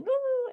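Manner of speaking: high mock whimper; little wail, like a child crying; imitated crying. Small boy crying in the forest, imitated whimper. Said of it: "Isaac's just crying".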